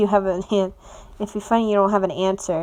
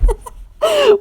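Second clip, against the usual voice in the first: laugh; 6 dB louder than usual. Acted. Laugh between lines.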